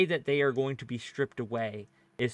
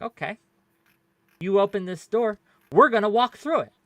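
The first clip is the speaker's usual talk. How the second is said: higher, louder retold threat; imitating Mitch McConnell; 9 semitones higher, 10 dB louder than usual. Debater quoting a senator's comeback, raised mock-defiant voice. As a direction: voice a politician's challenge, pitched up and building louder. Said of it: "is like".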